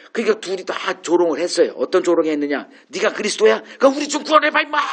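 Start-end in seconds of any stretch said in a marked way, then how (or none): 2.9-4.8 십자가 위 강도의 높아지는 조롱조 비아냥; mocking jeer. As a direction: taunt him like a scornful criminal on the cross. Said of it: "이제 이렇게 조롱하고 있는 거예요".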